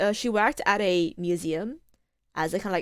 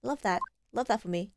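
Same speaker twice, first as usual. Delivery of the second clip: ordinary talk, nothing marked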